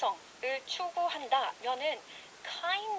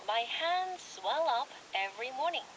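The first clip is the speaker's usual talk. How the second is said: slow, clear recap read; slower than usual. Shown as a demonstration. Host recapping the phrase slowly for listeners. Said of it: "다시 한번 리캡 해드릴게요".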